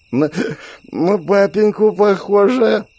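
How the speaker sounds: high, slow stumbling slur; drunk slurring. Acted. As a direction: drunk and squinting. Drunk woman peers at girl.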